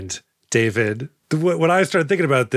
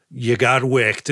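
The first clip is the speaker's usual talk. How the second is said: slow deadpan; much slower than usual. Retold or imitated. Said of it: "they go".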